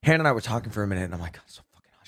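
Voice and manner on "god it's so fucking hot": hushed, breathy whisper; whispered complaint. Retold or imitated. Whispering to Hannah in the hot room, hushed complaint. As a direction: whisper it so nobody wakes.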